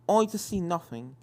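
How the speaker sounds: plain reading voice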